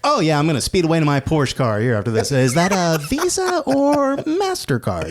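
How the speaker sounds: high-pitched, carefree salesman put-on.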